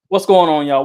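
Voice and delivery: loud, upbeat show opener; podcast host greeting. Acted. Host welcoming listeners to the episode, loud and upbeat. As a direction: kick off the episode, hype it up.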